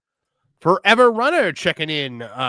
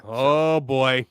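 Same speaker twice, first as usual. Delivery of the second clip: usual speaking voice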